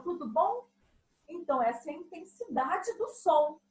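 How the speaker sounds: normal talking voice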